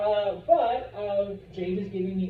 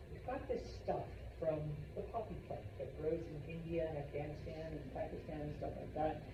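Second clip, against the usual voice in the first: softer sly offer; 3 semitones lower, 10 dB quieter than usual. Retold or imitated. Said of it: "the British go to China".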